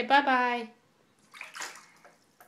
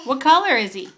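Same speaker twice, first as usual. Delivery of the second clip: loud; 3 semitones higher, 10 dB louder, faster than usual. Real, not acted. Exaggerated question to toddler.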